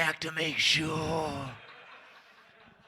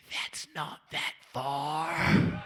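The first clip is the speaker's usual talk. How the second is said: mock crying; 4 dB quieter, much slower than usual. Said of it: "some men in here are like".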